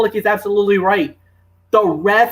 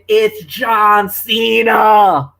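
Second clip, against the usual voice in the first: slow, louder mock announcer reveal; imitated announcer call; 5 dB louder, much slower than usual. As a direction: call out the surprise entrant, drawn out. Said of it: "take over for me by saying".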